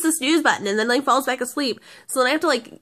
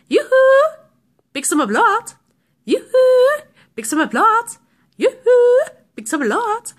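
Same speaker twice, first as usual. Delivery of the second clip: annoying alarm voice; 10 semitones higher, 6 dB louder, slower than usual. Retold or imitated. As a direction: mimic a chirpy salesman on repeat. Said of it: "the guy's voice is really, really annoying".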